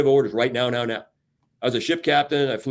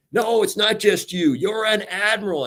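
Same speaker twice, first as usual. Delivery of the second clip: higher, slower and firm; insistent coworker; 8 semitones higher than usual. Retold or imitated. Coworker insisting to the admiral, high and firm. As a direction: insist on it, pitched up. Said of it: "quoted exchange".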